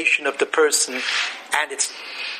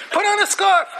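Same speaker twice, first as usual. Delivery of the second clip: mock parental yell, pitched much higher; nagging yell; 13 semitones higher than usual. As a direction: call it out like a fussing parent. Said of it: "So what do you yell out?".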